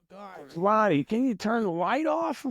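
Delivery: high, whiny plea to turn the light off; half-joking whine at a reading roommate; whiny complaint. Retold or imitated.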